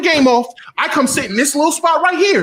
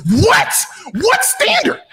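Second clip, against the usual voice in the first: ordinary delivery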